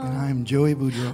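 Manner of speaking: rough, worn-out hoarse voice. Real, not acted. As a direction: speak through a hoarse throat. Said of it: "what's going on there?".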